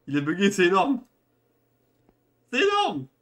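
loud high laughing exclamation; excited laughing. Real, not acted. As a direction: shout it out, cracking up. Laughing at game bug.

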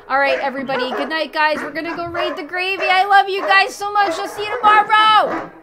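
loud, high cheerful shout; yelling goodbye. Real, not acted. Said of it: "Cutting out in the middle of yelling".

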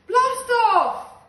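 huge high shout. Acted. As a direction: explode with joy. The explosive release.

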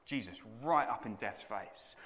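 normal talking voice

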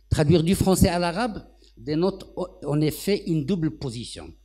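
measured formal lecture read. Acted. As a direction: deliver it like a written conference text. Reads prepared text at podium.

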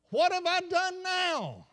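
high, slow, tearful plea; a hurt wife asking through tears, slowly; sobbing tears. Acted. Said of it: "through sobbing tears".

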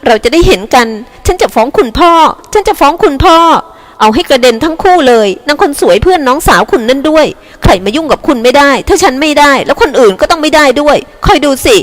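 angry wife's high-pitched threats, acted; shrieking wife, toned down. A narrator's reading. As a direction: threaten your husband in a high, indignant voice. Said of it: "เสียงกรี๊ดกรี๊ดของภรรยา". (ภรรยาโวยวายขู่สามีด้วยเสียงสูง)

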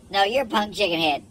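usual speaking voice

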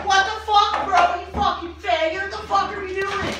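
high, loud angry outburst; angry yelling. Acted. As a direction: blow up at your friend over the mess. Acted quarrel over the mess.

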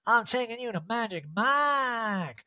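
Erica. Retold or imitated. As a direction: announce it grandly in a put-on voice. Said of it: "where Erica's like".

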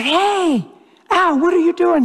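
excited greeting. Retold or imitated. Excitedly greeting an old coworker.

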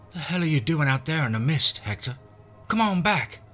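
loud, high yokel voice; calling out. Acted. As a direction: shout back into the mist. Stranger calls back from fog.

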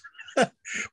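a short airy chuckle at his own joke; real amused chuckle, mostly air. Real, not acted. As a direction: let out a quick breathy laugh. Laughs at own monkey joke.

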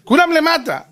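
high-pitched neighborhood call; mock calling out. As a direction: holler that everyone is downstairs. Calls out as the playing children.

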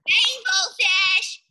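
high, slow small fish voice; little fish calling. Acted. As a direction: call out in a tiny high voice. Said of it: "he called".

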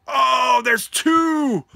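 high, loud delighted shout; excited surprise. Real, not acted. Opening a gift and finding a second, excited shout. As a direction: burst out with it, stretching the last word.

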